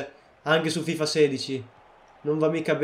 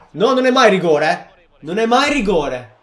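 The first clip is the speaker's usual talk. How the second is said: loud, higher, indignant protest; angry protest; 4 semitones higher, 8 dB louder than usual. Real, not acted. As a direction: protest the penalty call hard. Protesting referee call. (protesta indignata)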